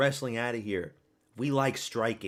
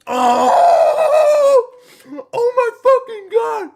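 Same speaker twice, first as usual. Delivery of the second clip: screaming high and loud at a knockout; real shock, full-voiced yell; huge shocked scream; stunned shout; 17 dB louder, much slower than usual. Real, not acted. Knockout watched live.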